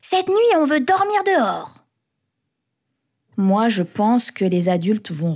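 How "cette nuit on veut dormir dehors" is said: put-on high, eager kid announcing a plan; excited child. A narrator's reading. Ilyes tells the adults at table the plan, excited child. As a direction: pipe up like an excited boy. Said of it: "Ilyes dit".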